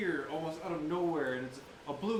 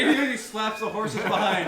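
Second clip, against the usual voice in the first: ordinary talk, nothing marked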